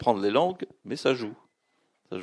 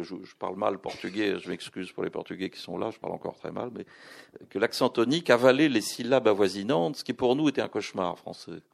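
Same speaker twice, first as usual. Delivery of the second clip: ordinary talk, nothing marked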